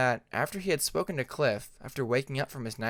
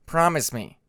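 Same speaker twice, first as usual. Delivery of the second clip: a firm, louder stern demand; voice hardening; 2 semitones higher, 7 dB louder than usual. Acted. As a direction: press the promise, suddenly stern. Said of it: "his voice hardening for a moment".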